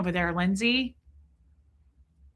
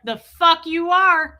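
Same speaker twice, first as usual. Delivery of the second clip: loud, slow put-down; mock shout; 6 semitones higher, 8 dB louder, slower than usual. Aaron forbidding Marilyn's holiday weekend, slow mock shout. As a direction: snap the refusal out slowly. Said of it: "Aaron was like".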